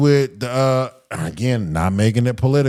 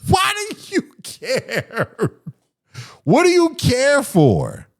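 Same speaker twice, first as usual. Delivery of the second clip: high, drawn-out exasperated squeal; incredulous outburst; 11 semitones higher, slower than usual. Real, not acted. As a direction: stretch it out high in comic disbelief. Incredulous rant at [name]'s critics.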